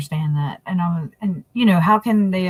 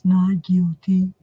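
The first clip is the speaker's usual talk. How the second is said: slow, drawn-out "Not guilty"; lightly voiced flat plea; imitating defendant; slower than usual. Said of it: "That's how he sounds".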